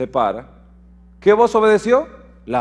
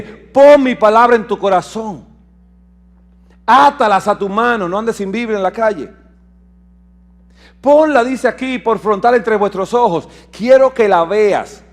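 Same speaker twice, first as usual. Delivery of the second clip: louder, emphatic scripture reading; preaching scripture; 4 semitones higher, 5 dB louder than usual. Acted. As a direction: drive the command home. Proclaims God's word.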